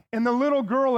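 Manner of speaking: ordinary delivery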